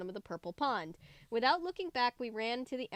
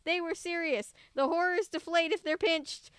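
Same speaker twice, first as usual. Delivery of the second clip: excited urging; 6 semitones higher than usual. A narrator's reading. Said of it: "I shouted to my family".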